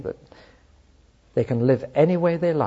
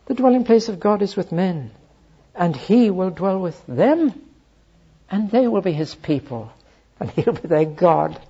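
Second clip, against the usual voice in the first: awed proclamation; 6 semitones higher than usual. Retold or imitated. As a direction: proclaim it with awe. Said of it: "the astonishment as the angel cries out".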